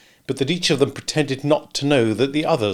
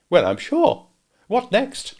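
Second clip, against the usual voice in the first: sniffy lady; 12 semitones higher, slower than usual. A narrator's reading. Sarah Pocket voiced.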